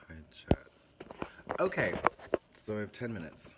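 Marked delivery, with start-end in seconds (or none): 0.1-1.6 breathy whispered greeting to chat; whispered hello. Real, not acted. Streamer whispering hi to chat, hushed.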